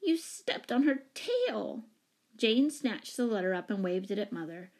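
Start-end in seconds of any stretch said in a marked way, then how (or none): 0.0-1.8 put-on slow, high, accusing child; plaintive little-girl protest; wailing. A narrator's reading. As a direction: wail the reproach, dragging the last word. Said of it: "wailed Betsy".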